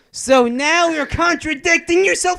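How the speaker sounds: high, loud, exasperated rant; worked-up shout, throat-straining and high; emphatic yelling. Real, not acted. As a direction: yell it in indignant protest. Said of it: "'cause you're yelling".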